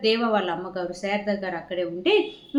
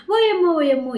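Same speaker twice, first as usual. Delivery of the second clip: high, loud startled cry; a startled wake-up yelp of surprise; surprised exclaim; 9 semitones higher, 7 dB louder than usual. Acted. Husband waking to a busy house, startled exclaim. Husband wakes to morning bustle.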